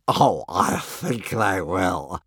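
very slow, lowish amused dragon; mirthful creature drawl; crying with laughter. A narrator's reading. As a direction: drag it out, savouring the joke. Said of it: "crying with laughter".

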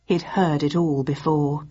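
usual speaking voice

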